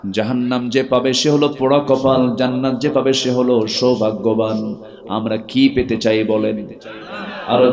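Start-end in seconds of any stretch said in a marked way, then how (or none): none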